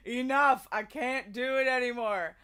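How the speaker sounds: a tired mom begging to stop the song; mother's exasperated plea. Retold or imitated. Mom begging her child to stop replaying the song, exasperated. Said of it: "and she was like".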